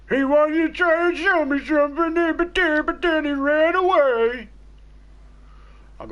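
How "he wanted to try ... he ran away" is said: high, loud, drawn-out mock wail; jeering, slowed-down high-pitched retelling. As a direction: stretch it out slowly on a high pitch. Mocking the caller who fled.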